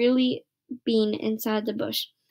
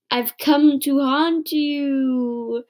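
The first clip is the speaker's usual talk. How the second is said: spooky ghost voice; 4 semitones higher, 3 dB louder, much slower than usual. Acted. Bean haunting as ghost.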